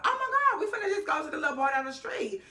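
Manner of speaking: put-on a high, laughing, breathless party girl voice; squealing excited girl. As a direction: squeal the invitation like a tipsy friend. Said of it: "one of the girls was like".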